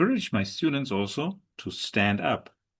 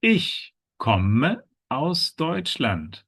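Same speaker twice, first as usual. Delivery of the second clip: slow, careful ICH KOMME AUS DEUTSCHLAND as one sentence; high ICH, then evenly spaced slow words; slow model sentence; 5 semitones higher, much slower than usual. Shown as a demonstration. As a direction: model the whole sentence slowly for learners. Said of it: "how does each character introduce".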